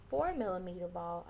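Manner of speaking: usual speaking voice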